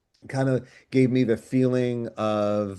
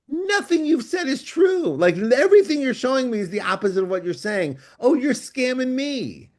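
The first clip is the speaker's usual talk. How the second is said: high mock outrage; 13 semitones higher than usual. Viewer realizing the film deceives.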